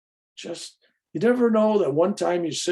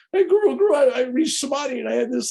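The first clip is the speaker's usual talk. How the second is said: much higher, louder breathless calling; a student bursting to tell the guru; mock eager student; 12 semitones higher, 4 dB louder, faster than usual. Student running to the guru, hammed-up excited calling.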